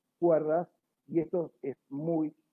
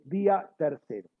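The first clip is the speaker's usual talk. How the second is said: ordinary delivery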